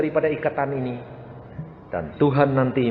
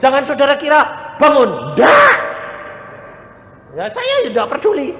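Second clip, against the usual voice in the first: shouted high and loud at the congregation; forceful sermon shout; 13 semitones higher, 8 dB louder than usual. Real, not acted. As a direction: preach it at full force, insisting. Preacher rebukes the congregation. (berseru keras kepada jemaat)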